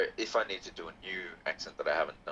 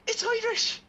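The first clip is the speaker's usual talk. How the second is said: very high-pitched cartoon Irish; leprechaun Irish accent; 4 dB louder, slower than usual. Shown as a demonstration. Host defending the accent, in high leprechaun Irish. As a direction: insist in a squeaky leprechaun brogue. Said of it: "That's a leprechaun accent".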